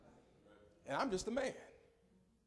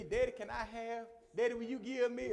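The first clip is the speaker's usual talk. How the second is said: put-on sweet asking child; 5 semitones higher than usual. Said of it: "it used to be".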